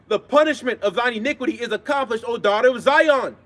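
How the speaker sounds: ringing scripture proclamation; scripture reading. Acted. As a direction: proclaim each verse with drawn-out line endings. Reads scripture aloud.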